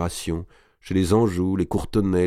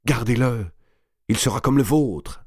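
higher, coaxing insistence; insisting; 5 semitones higher than usual. A narrator's reading. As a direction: press her to accept. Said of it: "insista madame de Bouville". (insistance pressante)

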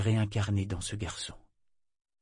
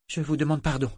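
précipitamment; 6 semitones higher than usual. A narrator's reading. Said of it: "dit-il précipitamment".

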